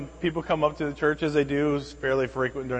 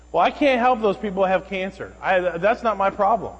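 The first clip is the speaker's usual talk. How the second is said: lightly imitated indignant stranger, louder and higher; retold protest in a raised voice; dismissive woman; 4 semitones higher, 5 dB louder than usual. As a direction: brush it off like an indignant complainer. Voices woman dismissing pastor's reason.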